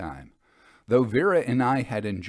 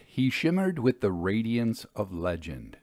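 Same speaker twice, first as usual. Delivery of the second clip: ordinary delivery, nothing marked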